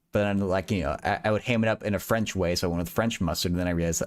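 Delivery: ordinary talk, nothing marked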